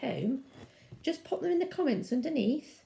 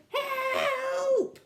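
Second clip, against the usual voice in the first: high, loud drawn-out wail; squealing mock-terrified shout; cry for help; 5 dB louder than usual. A narrator's reading. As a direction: scream it in hammed-up panic. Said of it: "and the other cried".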